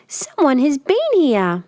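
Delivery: put-on high squeaky baby bear; squeaky little bear. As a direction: squeak it out like a tiny cub. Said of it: "in a very squeaky voice".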